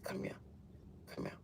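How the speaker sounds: very soft, whispered summons; hushed beckoning. Retold or imitated. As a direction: whisper someone over to the bedside. Calling someone over from hospital bed.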